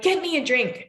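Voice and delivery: imitating a bossy child; demanding child. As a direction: bark the order like a bossy kid.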